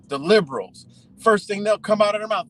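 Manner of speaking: ordinary talk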